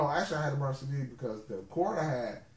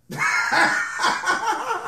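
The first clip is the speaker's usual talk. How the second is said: loud high laugh; 8 dB louder than usual. Real, not acted. Laughing at Android joke.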